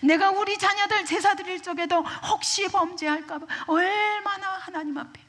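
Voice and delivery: high, loud acted protest of Job; aggrieved lament. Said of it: "너무너무 억울해요". (억울함을 높은 소리로 호소하듯)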